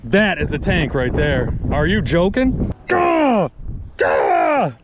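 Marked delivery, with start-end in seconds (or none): 2.9-4.7 real yells of delight; excited shout. Real, not acted. Angler reacting to a heavy weigh-in, real excited shouts. After weighing a big fish.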